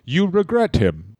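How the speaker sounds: low old-champion impression; low, solemn wrestler-father voice; Bruno Sammartino. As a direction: hand down grim advice in character. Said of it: "That's better".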